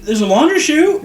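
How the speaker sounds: put-on high, bright girl's question; surprised question. Hannah suddenly suggesting escape route.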